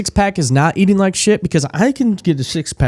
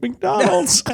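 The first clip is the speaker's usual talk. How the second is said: gleeful McDonald's; 12 semitones higher than usual. Retold or imitated. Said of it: "and be like".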